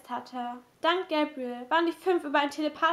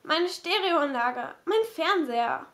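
ordinary delivery

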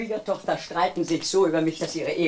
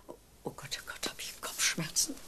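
much softer, unvoiced groan of pain; pained whisper; 12 dB quieter than usual. Mother interrupts herself over her headache, whispering in pain. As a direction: whisper through a throbbing headache.

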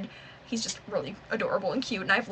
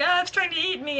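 a quoted yelp, higher and louder; mock Sokka yelp; 7 semitones higher, 4 dB louder than usual. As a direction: imitate Sokka crying out in panic. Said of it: "Sokka's like".

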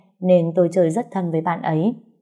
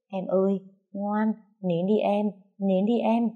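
soothing; 3 semitones higher, 5 dB quieter than usual. A narrator's reading. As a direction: gently hush a little one, pitched up. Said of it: "vừa bế vừa dỗ". (dỗ dành em nhỏ nhẹ)

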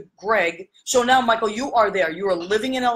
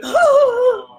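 sharp high excited gasp; little girl's astonished gasp; retold gasp; 8 dB louder than usual. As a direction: gasp in high, loud and sudden. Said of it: "and she was gasping".

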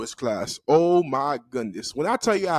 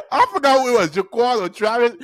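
high, laughing delivery; talking through laughter; 8 semitones higher than usual. Real, not acted. Storyteller recalling who refused the handshake, laughing. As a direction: barely get it out while cracking up.

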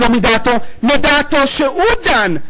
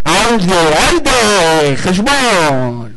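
stern judge; 5 semitones lower, slower than usual. Acted. Court turns to the accounting.

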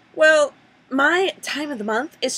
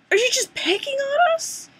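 whiny accusation. Acted. To the brand manager, a high, put-upon whine.

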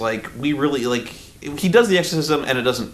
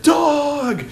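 high, louder silly creature shout; goofy cry; 4 dB louder than usual. Pretend. The dog inside her split head, in a high, goofy shout. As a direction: yell it like a cartoon dog popping out.

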